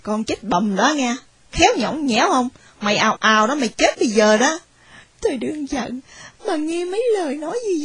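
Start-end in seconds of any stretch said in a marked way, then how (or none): 0.0-4.6 put-on much lower, menacing older woman; aunt threatening the struggling girl. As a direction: growl the threat like a harsh aunt. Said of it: "mợ tôi mới ứng tiếng nói rằng".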